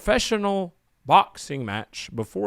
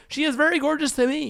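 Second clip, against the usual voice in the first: high silly voice; 12 semitones higher than usual. Acted. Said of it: "That's your warm-up right there".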